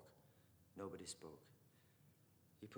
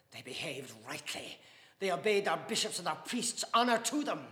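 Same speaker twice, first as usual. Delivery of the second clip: raging father; 11 semitones higher, 18 dB louder than usual. Acted. Father raging about Parnell's betrayers, bitter and loud.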